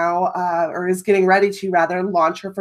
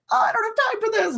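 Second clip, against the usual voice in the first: exasperated; 13 semitones higher, 5 dB quieter, faster than usual. Retold or imitated. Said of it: "my first reaction was like".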